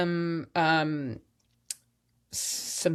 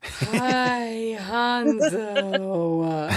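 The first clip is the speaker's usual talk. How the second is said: higher sing-song hello to a dog; pet voice; 6 semitones higher, much slower than usual. Real, not acted. Greeting Hanzo on screen, higher pet voice. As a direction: coo a greeting at the pup.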